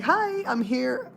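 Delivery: high, drawn-out lilting greeting; friendly hi. Retold or imitated. Customer shows how she greeted the cashier, friendly. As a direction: sing out a slow, friendly hello. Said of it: "I said a friendly hi".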